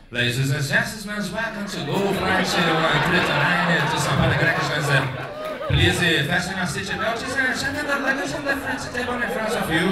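loud, formal cabin-crew announcement; mock in-flight announcement. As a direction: read it like a clumsy pilot. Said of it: "It goes like".

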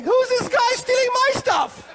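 high, loud imitation of the other man's outrage; mock indignant shout. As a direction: shout it as the rival who feels robbed. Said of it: "he probably thought the same thing".

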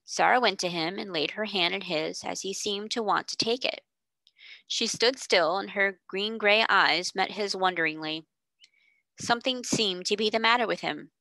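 usual speaking voice

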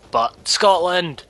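proud outburst. Real, not acted. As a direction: belt out the name with pride. Joking patriotic outburst.

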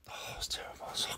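whispered aside to himself; hushed self-mocking whisper. Real, not acted. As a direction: breathe it out, embarrassed. Mocking own accent attempt.

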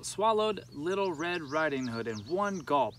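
usual speaking voice

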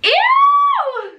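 high, loud ew of disgust; disgusted shriek. Real, not acted. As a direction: shriek in revulsion. Sees puke-like bean.

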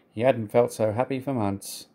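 plain reading voice

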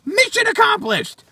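very high-pitched mock triumph; mocking congratulation. Answering an attention-seeking prankster, mock triumph. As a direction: exclaim it high, in mockery. Sarcastic verdict on prank sender.

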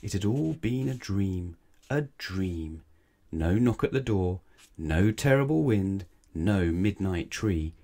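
plain reading voice

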